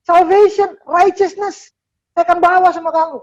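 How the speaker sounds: high, emphatic proclamation; God declaring. Acted. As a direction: declare it as God, pitched far up. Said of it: "Abis itu dia bilang apa?".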